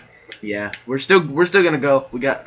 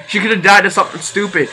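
fast and louder, laughing as you speak; 6 semitones higher, 4 dB louder, faster than usual. Real, not acted. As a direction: giggle through a silly joke. Joking about Anya dying.

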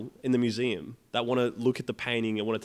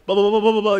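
higher, louder nonsense gabble; mock French babble; 9 semitones higher, 8 dB louder, faster than usual. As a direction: mimic a fast foreign torrent you can't follow. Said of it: "just full on speaking French".

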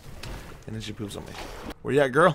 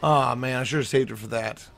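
usual speaking voice

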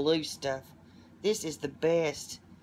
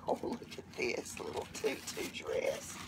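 hushed, unvoiced and much lower in pitch; adoring whisper; 11 semitones lower, 7 dB quieter than usual. Real, not acted. Cooing over a baby dress.

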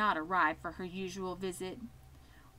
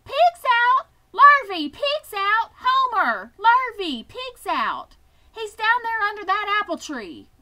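very loud, much higher panicked yells; shouting alarm; 12 semitones higher, 13 dB louder than usual. Acted. Farmer's wife yells for the men, very loud high alarm. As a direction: raise the alarm from the kitchen window. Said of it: "she immediately shouted for the men".

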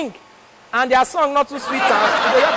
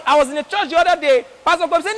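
ordinary delivery

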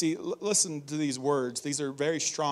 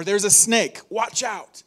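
sharp, louder call about a snake; urgent warning; 4 semitones higher, 7 dB louder than usual. Retold or imitated. Preacher imitating someone spotting a snake, sharp loud warning. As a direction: warn someone of a snake, high.

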